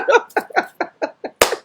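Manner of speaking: high unvoiced giggle; a real amused giggle; breathy laugh. Real, not acted. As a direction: giggle at your own joke. Laughing at own quip.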